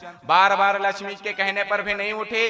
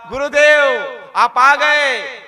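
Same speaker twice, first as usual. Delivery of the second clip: pleading cowherd; 3 semitones higher, 8 dB louder than usual. Acted. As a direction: cry out to the guru who finally came. (ज़ोर से, धीमे, विनती भरी पुकार)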